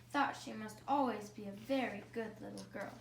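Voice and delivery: ordinary delivery, nothing marked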